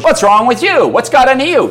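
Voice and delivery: loud, high-pitched baffled puppy; indignant puppy. Acted. Puppy talks back to owner after late scolding, indignant. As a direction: answer back as the affronted dog. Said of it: "he looks at me and goes".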